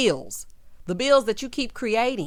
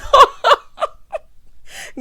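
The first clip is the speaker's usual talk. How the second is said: very loud, high cackle; uproarious laugh; 13 dB louder than usual. Real, not acted. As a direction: burst out laughing hard at your own line. Laughs at own quip.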